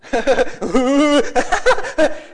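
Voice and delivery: high wail breaking into cackles; imitated laughing and crying. Preacher imitating his son, laughing and crying at once. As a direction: mix mock sobs with helpless giggles. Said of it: "He's laughing and crying".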